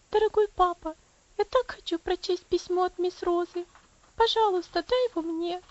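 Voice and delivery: put-on high, pleading little girl voice; девочка Элси просит. Girl Elsie pleading with father. (высоким голосом девочки, умоляюще)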